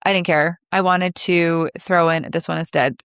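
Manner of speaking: ordinary delivery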